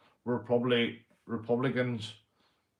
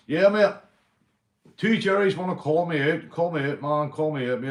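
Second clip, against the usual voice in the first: higher and louder, shouting the challenge; fired-up call-out for charity; 5 semitones higher, 6 dB louder than usual. Real, not acted. Calling out rivals to fight.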